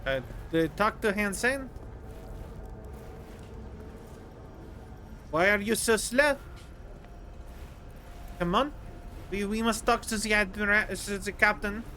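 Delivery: light mock French accent with z for th. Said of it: "keep wanting to use the French accent".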